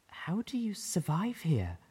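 breathless, slow whispered question; Velvet's near whisper. A narrator's reading. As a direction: barely whisper it, shaken by what you see. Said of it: "Her voice was almost a whisper".

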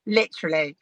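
normal talking voice

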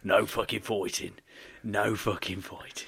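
low Brummie gangster parody; mock Brummie. Playing a kangaroo Shelby, low mock Brummie. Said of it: "kangaroo Shelby".

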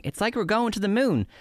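high-pitched mimicry; put-on high voice for a quote; quoting them. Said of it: "I love how they said".

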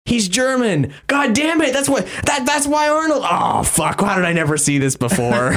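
high-pitched indignant realisation; mock outraged discovery; retold exasperated outburst. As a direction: act out a floored fan's sudden epiphany, high and worked up. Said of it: "I'm like".

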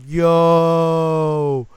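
loud, hyped yo; excited yo. Retold or imitated. Fan yelling at the TV, loud hyped yo. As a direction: shout it at the screen. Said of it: "I'm like".

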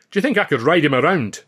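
keen Scot. A narrator's reading. Billy asks eagerly.

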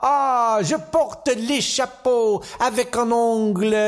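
high, drawn-out mock French; Gene Kelly. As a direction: gush grandly in hammed-up French. Kelly gushes in hammed-up French.

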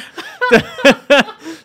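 loud laugh bursting out high. Real, not acted. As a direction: crack up at your own joke. Laughing at own joke.

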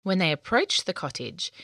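usual speaking voice

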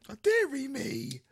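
slow, high, hammed-up indignation; exaggerated posh huff; mock posh outrage. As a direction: stretch it out as a scandalised toff. Playing up the teased posh persona.

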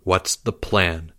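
slow, hushed question; soft, low and secretive. A narrator's reading. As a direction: ask it low, like a conspirator. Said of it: "Leo asked in a low voice".

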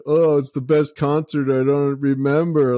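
put-on old boss doing a pothead voice; mocking stoner impression. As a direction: tease someone with a dopey stoner voice. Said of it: "make fun of me like that".

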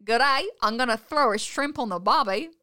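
loud, hammed-up Australian accent; bad Australian accent. Shown as a demonstration. Trying accents on request, bad Australian accent. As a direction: put on a clumsy broad Aussie accent.

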